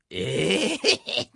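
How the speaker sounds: high, drawn-out whining 'ええー'; mock wail at losing the tape; wailing dismay. Apprentice wailing as his tape is taken.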